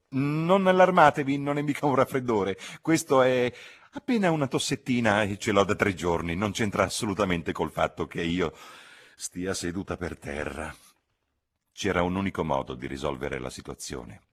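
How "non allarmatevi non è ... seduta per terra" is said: high, loud, brushing off the cough; fussy cousin protesting. Acted. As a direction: reassure them too insistently. Charlotte fussing, loud. (cugina agitata che minimizza la tosse)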